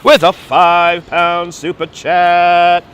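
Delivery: slow, drawn-out announcer voice; announcer read. Acted. Streamer announcing a donation in slow announcer voice. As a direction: stretch it like a host.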